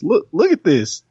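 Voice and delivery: high, excited Doctor voice. Retold or imitated. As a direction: pitch it way up in wonder. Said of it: "the doctor pulls her on in and says".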